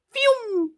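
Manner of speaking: high-pitched imitated fly-by whoosh; high whoosh noise. Shown as a demonstration. Host showing a joke going over her head, high whoosh. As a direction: whoosh like something zooming overhead.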